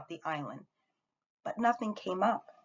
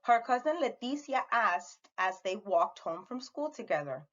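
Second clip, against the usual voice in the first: usual speaking voice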